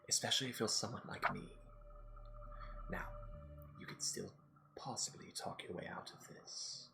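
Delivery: hushed unvoiced whisper. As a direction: keep it to a whisper. Last whispers throughout.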